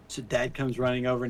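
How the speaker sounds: ordinary delivery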